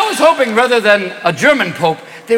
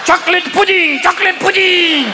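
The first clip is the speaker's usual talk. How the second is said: much higher, laughing mock announcement; high giddy old pope shouting about pudding; demented pope; 9 semitones higher than usual. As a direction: shout it like a confused old man to the crowd.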